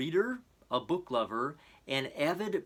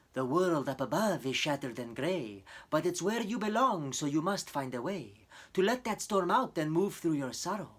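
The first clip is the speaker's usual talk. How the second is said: lightly voiced gentle spider; a wise little helper guiding kindly; comforting spider; 3 semitones higher than usual. A narrator's reading. Spider dangling beside the rhino, lightly voiced and comforting. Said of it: "to comfort and guide him".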